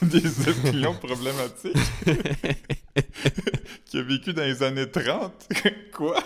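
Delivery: words spilling through laughter; laughing. Real, not acted. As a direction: laugh while asking. Rit de sa propre blague. (en riant aux éclats)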